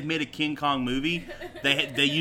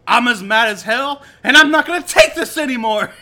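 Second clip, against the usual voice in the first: building from loud to a higher, faster shout; mock outraged shout; mock furious rant; 7 semitones higher, 9 dB louder than usual. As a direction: rage at the world, hammed up. Reciting Network rant.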